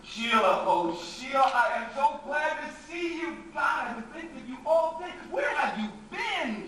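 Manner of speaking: loud, high, gushing relief; loud, high, gushing welcome; overjoyed greeting. Acted. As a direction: throw yourself at her with relief.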